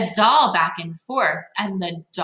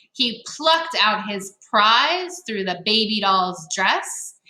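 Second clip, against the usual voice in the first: ordinary delivery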